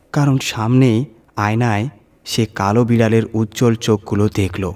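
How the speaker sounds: usual speaking voice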